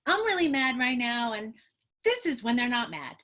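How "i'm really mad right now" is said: loud declaration of being really mad; mad demo line. Shown as a demonstration. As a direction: model the child's angry line out loud. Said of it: "I want you to say".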